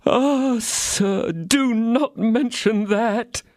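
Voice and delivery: high, slow, wailing innkeeper; lamentable tone. A narrator's reading. Innkeeper begging D'Artagnan to drop it, lamenting slowly. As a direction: plead in misery, drawn out. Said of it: "in a most lamentable tone of voice".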